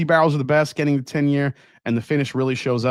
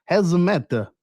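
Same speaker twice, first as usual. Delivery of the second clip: higher, badly faked French; bad French accent; 5 semitones higher than usual. Shown as a demonstration. Host answering a chat question, failed French accent. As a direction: have a hopeless go at French. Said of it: "I can't do a French accent".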